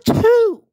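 loud high sneeze; full theatrical sneeze; big sneeze. Acted. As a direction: let a huge sneeze burst out. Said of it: "George cannot stop sneezing".

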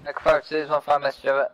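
steady, loud radio voice; measured call over the net; calm radio call for fire. Real, not acted.

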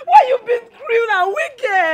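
slow, tearful outcry; wife accusing her husband through tears; crying accusation. Acted. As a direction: wail it through sobs, slowly.

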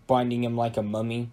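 usual speaking voice

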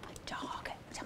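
soft whispered echo. Real, not acted. Counselor echoing a camper's answer in a whisper.

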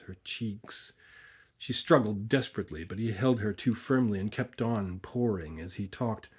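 plain reading voice

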